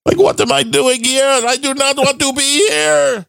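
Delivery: high-pitched, hammed-up tearful wail; mock weeping. Podcaster imagining a weeping audience member who can't watch, mock weeping. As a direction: laugh through a put-on, high sobbing voice. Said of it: "audience member that's fucking weeping".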